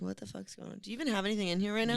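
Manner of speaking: hushed disbelief. Retold or imitated. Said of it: "I'd be like".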